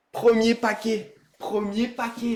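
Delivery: usual speaking voice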